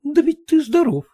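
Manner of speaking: high wife objecting; wife reminding him he's well. Acted. Wife to husband, high objecting voice.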